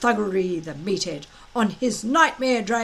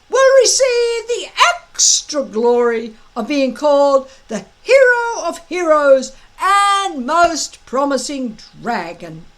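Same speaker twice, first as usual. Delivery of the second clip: loud, high, gloating drill-master voice; a big blustering Viking instructor gloating at his recruits; booming Gobber; 7 semitones higher, 9 dB louder than usual. Acted. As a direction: lord it over the boys with relish. Gobber lays out the test.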